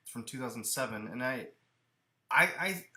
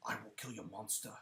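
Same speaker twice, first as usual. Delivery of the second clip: low, soft Cockney growl of a warrior; tough-guy Cockney Beowulf impression; Ray Winstone; 4 semitones lower, 12 dB quieter than usual. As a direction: drop low into a Cockney British accent. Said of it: "that very Cockney British accent".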